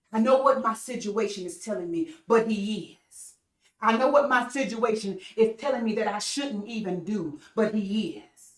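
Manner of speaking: ordinary talk, nothing marked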